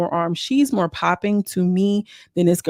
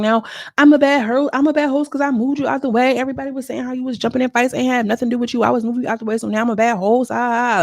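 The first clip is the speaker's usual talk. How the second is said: high and louder, fast in the middle; indignant mock rant; 5 semitones higher, 3 dB louder, faster than usual. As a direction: rant like the wronged host.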